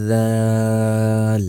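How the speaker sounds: জাল as th in "this", vowel held very long; tongue tip to upper teeth, stretch জাল; long-drawn জাল. Shown as a demonstration. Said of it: "তিন আলিফ পরিমাণ টান". (জিহ্বার আগা দাঁতে লাগিয়ে জাল লম্বা টেনে)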